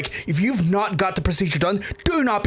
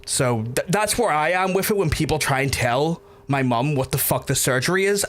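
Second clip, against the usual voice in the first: normal talking voice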